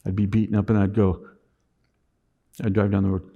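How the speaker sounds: ordinary talk, nothing marked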